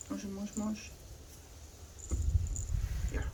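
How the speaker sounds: high, soft calling of the dog; hushed cooing call; cooing pet call. Real, not acted. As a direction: coax the pet gently.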